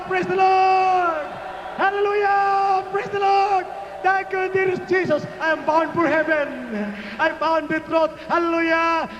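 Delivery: high, sustained cries of praise; full-force rallying preaching. Real, not acted. Preacher urging the healed to wave hands, high praise shout. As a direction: call out with long held words.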